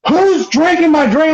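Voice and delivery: high, whining demand; hammed-up outraged accusation; mock indignation. Billy demands to know who took his drink, indignant.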